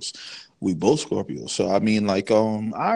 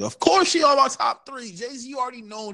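excited; 13 semitones higher than usual. Real, not acted. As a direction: insist on it, worked up. Emphatic reversal, pitched up.

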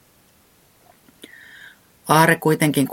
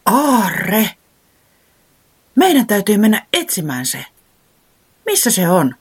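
higher, louder eager voice; excited; 7 semitones higher, 5 dB louder than usual. A narrator's reading. Owl thrilled by the treasure map, calls out higher and louder. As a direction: burst with treasure-hunt excitement. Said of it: "Pöllis innostui".

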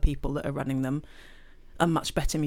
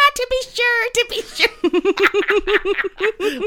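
high, loud mock Irish accent; 10 dB louder than usual. Imitating her friend's mock Irish accent at a wedding. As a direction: ham up a stage Irish accent. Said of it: "in the car park going".

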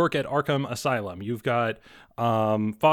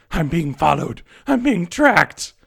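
higher, louder, slow frantic plea; panicked raving; 6 semitones higher, 7 dB louder, slower than usual. Acted. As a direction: rave like a hunted madman. Delirious patient raving to doctors.

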